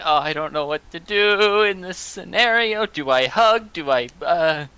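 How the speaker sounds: awkwardly flustered inner voice; flustered Hazel. Acted. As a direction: play her lost for what to do. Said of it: "is like".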